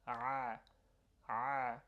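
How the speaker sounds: ع- squeezed from the throat; throaty ain. Shown as a demonstration. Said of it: "Sounds like this".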